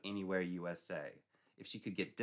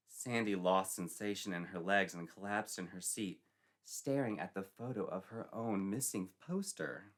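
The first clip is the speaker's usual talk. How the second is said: ordinary delivery, nothing marked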